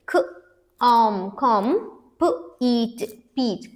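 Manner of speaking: ख, आम blended to कम, then प, ईट to पीट; sounding out. Shown as a demonstration. Teacher splitting compete for students, each sound said apart. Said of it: "now let's split this".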